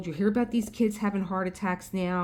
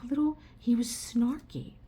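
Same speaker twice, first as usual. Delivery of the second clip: whispered aside; 5 semitones higher than usual. Real, not acted. Whispered aside about doctor.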